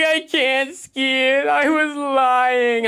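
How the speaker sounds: put-on high, slow, loud whining child; whiny kid voice. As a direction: drag it out like a sobbing kid.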